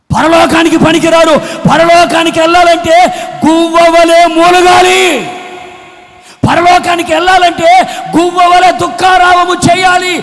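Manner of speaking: loud, rising sermon cadence, pleading with the crowd; full-force preaching. Acted. Preacher urging the church to pray with tears, fervent and loud. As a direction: drive each phrase home like a revival preacher.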